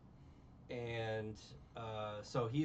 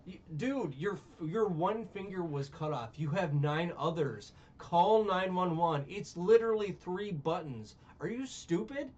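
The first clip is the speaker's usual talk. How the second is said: raised, louder mock berating of a fool; exasperated scolding; 5 semitones higher, 6 dB louder than usual. Scolding the movie character who won't call 911, loud mock exasperation. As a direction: scold him like he's an idiot. Mocking the movie's dumb victim.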